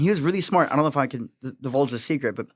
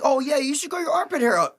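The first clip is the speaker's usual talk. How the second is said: higher, breezy mock compliment; mimicking a pickup neg; 9 semitones higher than usual. Imitating the guy negging his girlfriend, mock breezy. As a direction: toss off the backhanded line lightly.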